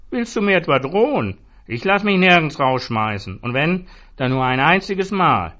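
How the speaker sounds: high, loud defiance; angry drunk standing his ground; defiant anger. A narrator's reading. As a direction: refuse to be thrown out, loudly. Zoran threatened with eviction.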